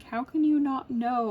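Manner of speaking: high, loud mock incredulity; imitated exasperated husband. Husband questions his crying wife, mock exasperation. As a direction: press the question, exasperated, as an impression. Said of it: "He's like".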